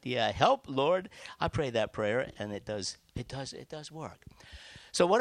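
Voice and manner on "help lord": put-on higher, louder Ozark-accented "Help, Lord". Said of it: "with his Ozark, uh, uh, uh, ex-, uh, accent".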